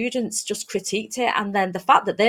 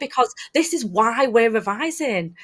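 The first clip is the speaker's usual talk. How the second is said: reassuring teacher; 7 semitones higher than usual. Retold or imitated. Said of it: "you say".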